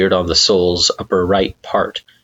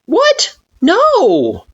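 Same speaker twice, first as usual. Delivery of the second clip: Frisk denying; 5 dB louder than usual. Acted.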